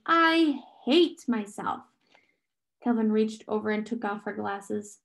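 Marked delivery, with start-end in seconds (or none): 0.0-1.8 slow, high self-loathing; shaky, higher-voiced misery. A narrator's reading. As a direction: drag it out in upset. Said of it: "Meg said shakily".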